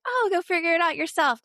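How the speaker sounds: high, quick mock-exasperated brush-off; dismissive parent. Parent tells bored crying child to sort it out, dismissive. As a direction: toss it off like a fed-up parent.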